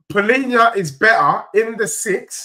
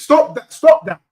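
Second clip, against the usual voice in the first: higher, louder stop that; 7 semitones higher, 5 dB louder than usual. Real, not acted. Streamer telling the fans in chat to stop, loud. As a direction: snap it at them twice.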